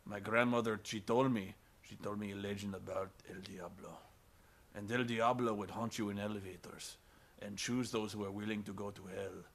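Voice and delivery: imitating the wise security guard from Devil, low and hushed; solemn wise guard. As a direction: hush it down like an old legend. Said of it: "security guard who's like".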